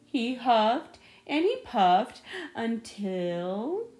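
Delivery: plain reading voice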